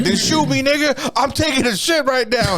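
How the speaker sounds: high, defiant mock voice of the guy on the toilet; mock high-pitched defiance. Guy on the toilet taunting the cops, high mock voice. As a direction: squeak it out like a cornered guy refusing to budge. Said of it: "He's like".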